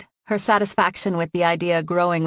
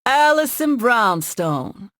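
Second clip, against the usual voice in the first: slow, higher, hammed-up Southern accent with a rising end; exaggerated Southern accent; 6 semitones higher, 4 dB louder, much slower than usual. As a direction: stretch the name out in a mock Southern accent. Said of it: "in an exaggerated Southern accent".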